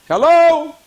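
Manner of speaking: loud, high hello into the line; calling out. Real, not acted. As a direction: call out sharply to a silent line. Calling out to silent caller.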